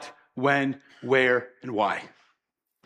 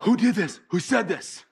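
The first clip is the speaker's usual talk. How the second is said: reeling panic; 3 semitones higher than usual. Retold or imitated. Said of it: "my mind starts, like, reeling".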